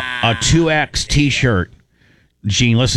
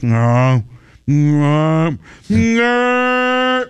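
bear growl imitation; 3 semitones higher, much slower than usual. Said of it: "this is the sound he made".

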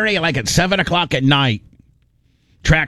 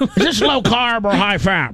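silly question voice; 6 semitones higher than usual. Shown as a demonstration. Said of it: "That's the way you ended".

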